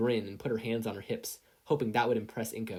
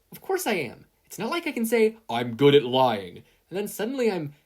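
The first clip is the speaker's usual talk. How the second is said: higher and louder insistence; protesting; 7 semitones higher, 9 dB louder than usual. A narrator's reading. Izuku protesting to Toshinori, higher and louder. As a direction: insist it's impossible.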